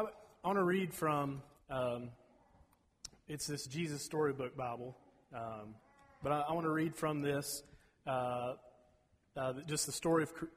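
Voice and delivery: ordinary delivery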